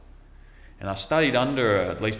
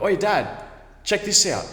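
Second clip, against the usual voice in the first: high, loud shout across the yard; retold excited call to dad, high and loud; 11 semitones higher, 6 dB louder than usual. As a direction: yell for dad to come look. Calling dad to see panther.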